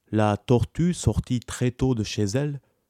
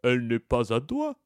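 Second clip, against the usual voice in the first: calm tortoise answer; understated tortoise retort; lightly voiced tortoise. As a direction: turn his words back on him, evenly. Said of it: "répondit la tortue".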